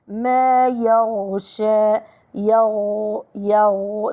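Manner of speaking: يغشى chanted slowly, sound flowing through the غ; slow recitation of يغشى with a lengthened غ; soft flowing ghayn. Shown as a demonstration. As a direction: let the غ in يغشى run on, unstopped. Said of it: "ننتبه لرخاوة الغين".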